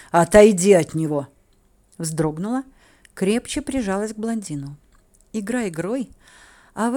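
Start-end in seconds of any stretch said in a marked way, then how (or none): 0.1-1.3 loud growled command; angry, forceful bellow; muffled roar. A narrator's reading. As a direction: roar it as an order. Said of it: "Глухой рык синеглазого графа".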